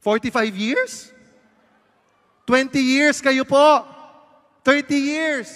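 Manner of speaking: high, slow loud call across the room; host calling out to a couple in the crowd. Real, not acted. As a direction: shout the question to the back rows. Shouting to audience members far off.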